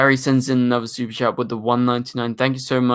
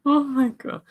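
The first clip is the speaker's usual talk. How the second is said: high, laughing disbelief. Real, not acted. Streamer reacting to a viewer's super chat, laughing in disbelief. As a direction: laugh through the words, pitch shooting up.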